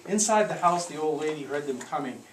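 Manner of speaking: plain reading voice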